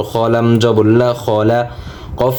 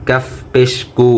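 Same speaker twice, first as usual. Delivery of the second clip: ordinary delivery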